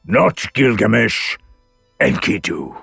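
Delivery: measured verdict, broken by a heavy pause; solemn declaration. A narrator's reading. God singles out the condemned man, solemn declaration. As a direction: hand down the sentence, falling on the name. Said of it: "Enlil declared".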